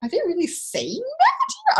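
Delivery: can't believe what you heard; disbelieving. Retold or imitated. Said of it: "I'm like".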